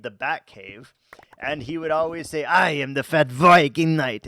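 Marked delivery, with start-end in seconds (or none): 2.4-4.3 louder mock Swedish Batman intro, V's said as W; hammed-up Swedish accent, much louder; Swedish accent impression. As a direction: boom it like a Swedish gamer playing Batman. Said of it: "he couldn't say V's 'cause he's deeply Swedish".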